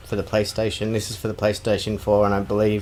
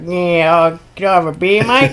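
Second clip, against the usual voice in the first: high, loud ocker bogan; pitched-up mock bogan asking for a beer; 8 semitones higher, 6 dB louder than usual. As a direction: ham up a rough Aussie bogan. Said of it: "he's like".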